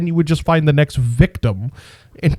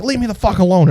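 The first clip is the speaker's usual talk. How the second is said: higher-pitched brush-off; imitating grandma waving off concern; imitated irritated grandma; 7 semitones higher, faster than usual. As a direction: lightly act out a fed-up grandma. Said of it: "She'd be like".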